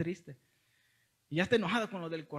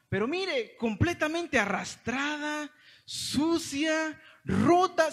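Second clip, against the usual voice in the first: higher, louder indignant listing; slow, higher, loud outrage; indignant exclaim; 8 semitones higher, 5 dB louder, much slower than usual. Real, not acted. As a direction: build outrage word by word. Describing the ruined box.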